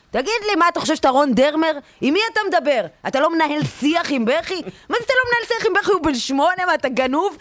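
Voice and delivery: high, indignant tirade; outraged rant. Real, not acted. Outraged storyteller ranting at a cold father about his crying son. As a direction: fire off the questions in disbelief.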